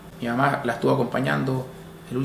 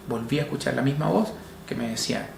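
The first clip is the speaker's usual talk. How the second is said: normal talking voice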